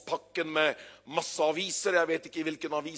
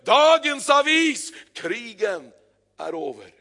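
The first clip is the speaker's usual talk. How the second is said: shout fading away; 4 semitones higher, 7 dB louder, slower than usual. Acted. Newsboy's cry fading as he hands out papers. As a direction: call out, then let it die down. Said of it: "Og han roper".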